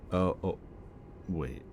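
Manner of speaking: ordinary delivery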